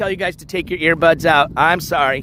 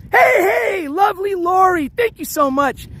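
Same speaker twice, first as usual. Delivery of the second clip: donation shout; 12 semitones higher than usual. Real, not acted. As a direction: yell the thanks out, hoarse and high. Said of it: "I have to reserve my yelling for the donations".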